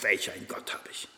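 flüsternd, staunend; ehrfürchtiges Flüstern. Pretend. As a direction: hauche es voller Staunen. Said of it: "liest du die Offenbarung und siehst". (Gläubiger flüstert staunend über seinen Gott)

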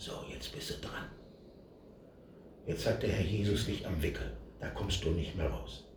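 whispered, hushed realization; awed inner voice. Retold or imitated. Stepping off the soapbox, knowing it in a whisper. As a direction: breathe it out, overwhelmed.

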